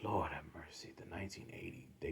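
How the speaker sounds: hushed, breathy dismay; whispered lament. Real, not acted. Fan mourning the Browns' losses in a whisper.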